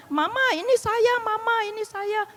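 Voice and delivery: lightly voiced child calling mama, pitched high; imitated pleading child. Child calls to unresponsive mother.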